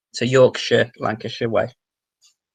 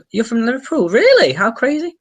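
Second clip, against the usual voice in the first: high, loud burst of real surprise; amazed surprise; 12 semitones higher, 8 dB louder than usual. Real, not acted. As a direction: react in high-pitched, excited disbelief. Reacting to a viewer from Liverpool.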